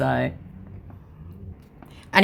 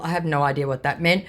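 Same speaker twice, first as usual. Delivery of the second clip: ordinary delivery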